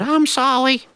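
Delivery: put-on a little kid sheepishly saying sorry; little brother voice. Little brother apologising to mama, high and sheepish.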